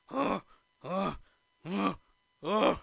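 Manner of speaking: short gasps before a sneeze; mock gasping, about to sneeze; imitated sneeze build-up. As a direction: series of fake pre-sneeze huffs.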